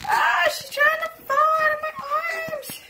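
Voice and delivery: high, loud mock-alarmed cry; playful alarm. Real, not acted. Mom as baby wriggles in her arms, playful alarm. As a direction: exclaim in a high, startled singsong.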